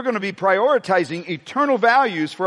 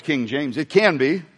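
ordinary delivery

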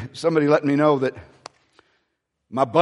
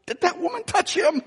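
mock disciples; 12 semitones higher, 4 dB quieter than usual. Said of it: "they were going".

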